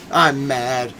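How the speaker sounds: ordinary talk, nothing marked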